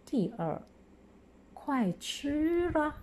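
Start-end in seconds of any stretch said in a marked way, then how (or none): 1.5-3.0 快吃了 pitched high and slow, 啊 sounding as 啦; 快吃了 read aloud. Shown as a demonstration. Teacher models the example high and slow for pupils. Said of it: "同学们来跟我一起读".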